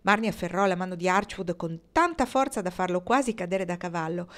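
ordinary delivery

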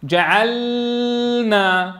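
جعلنا dragged far too long on the lam; mock exaggerated lingering on جعلنا; exaggerated mock reading; overstretched lam. Shown as a demonstration. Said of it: "أخي، ليش طولت؟".

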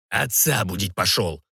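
low, slow put-down; hushing rebuke. A narrator's reading. Sorcerer silences the cook, low hushing rebuke. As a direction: shut him up with a low, measured reply. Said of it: "цыкнул на него колдун".

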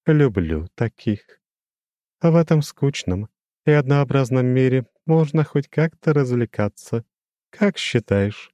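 lofty demon with a glassy ring; crystal ringing voice. A narrator's reading. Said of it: "своим хрустальным голосом". (высокий звенящий хрустальный голос демона)

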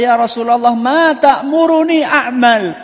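high, loud, slow Arabic recitation; hadith recitation. Acted. (membaca hadis dengan suara tinggi dan lambat)

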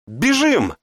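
high panicked shout. A narrator's reading. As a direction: scream at them to run. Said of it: "закричала Лара".

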